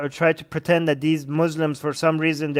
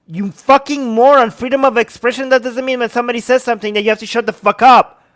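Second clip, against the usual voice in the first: loud, high, furious yelling; furious shout; 5 semitones higher, 5 dB louder than usual. Real, not acted. Ranting at the TV commentator, shouting furiously. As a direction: blow up at the commentator.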